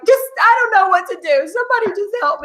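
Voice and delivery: high, rushed helpless pleading; mock panic. A wimpy mother in labour pleading for help, high and panicked. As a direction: beg for help like a total wimp. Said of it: "just, like, being like".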